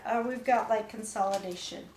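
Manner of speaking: normal talking voice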